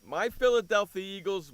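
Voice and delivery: normal talking voice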